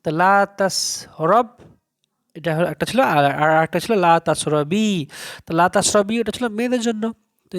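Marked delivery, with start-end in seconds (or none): none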